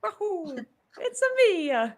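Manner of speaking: high cartoon plumber voice, way up; playful Mario catchphrase. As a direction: squeak it out like Mario. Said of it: "instead of saying".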